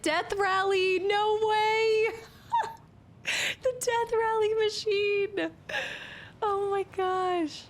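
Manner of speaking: high, loud thrilled squeal; excited squeal. Real, not acted. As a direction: squeal with real delight at the find. Thrilled to find arcade machine.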